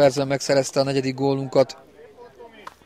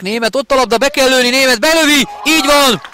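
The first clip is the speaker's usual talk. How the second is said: rising goal call, higher and louder; excited hungarian sports commentary; 10 semitones higher, 7 dB louder than usual. Real, not acted. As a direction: build to the goal, then erupt.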